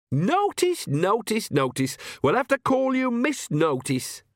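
high-pitched teasing, stepping down then up; mock the word back in a high voice; mocking repetition. A narrator's reading. Nanny teases Agnes in story.

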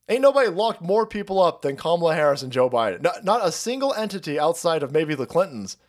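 ordinary delivery

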